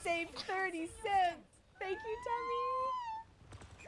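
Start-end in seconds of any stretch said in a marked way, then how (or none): 1.8-3.9 high, slow, fake-weepy wail; hammed-up tearful gratitude; mock crying thanks. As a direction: pretend to sob out your thanks. Overdone thanks for a tiny gift.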